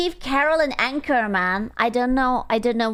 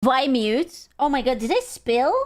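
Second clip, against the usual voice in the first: normal talking voice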